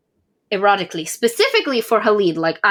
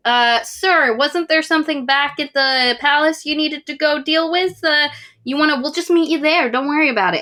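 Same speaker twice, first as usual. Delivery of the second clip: sly servant voice; 7 semitones higher than usual. Retold or imitated. Servant coaxing the king away.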